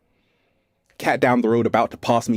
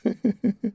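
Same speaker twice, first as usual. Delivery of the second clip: giggle; 7 semitones higher, 5 dB quieter than usual. Real, not acted. After retold story.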